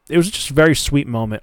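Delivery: normal talking voice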